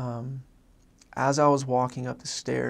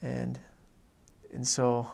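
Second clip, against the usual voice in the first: normal talking voice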